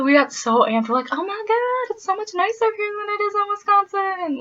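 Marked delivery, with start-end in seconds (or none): 1.1-4.4 very high gushing squeal; squealing excitement. Retold or imitated. Midwesterners to a fellow Midwesterner, high squealing gush. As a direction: gush like a thrilled teenager.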